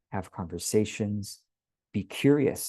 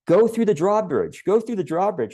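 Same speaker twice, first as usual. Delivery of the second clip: loud, high-pitched insistent sales push; emphatic pushing; 10 semitones higher, 7 dB louder than usual. Acted. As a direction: hammer it home, high and loud. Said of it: "you're just emphasizing".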